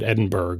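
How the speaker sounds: Edinburgh ending in a hard "burg"; phonetic mispronunciation. Retold or imitated. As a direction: read Edinburgh as spelt, with a hard g. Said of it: "you were just pronouncing it phonetically".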